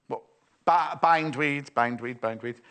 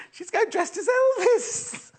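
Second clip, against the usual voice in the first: laughing; slower than usual. Real, not acted. Giggling at a story.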